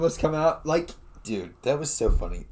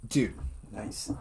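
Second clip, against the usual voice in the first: normal talking voice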